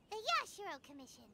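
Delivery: ordinary delivery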